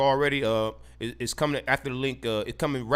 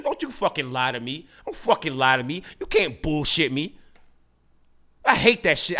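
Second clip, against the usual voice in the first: ordinary talk, nothing marked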